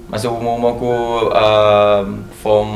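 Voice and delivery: normal talking voice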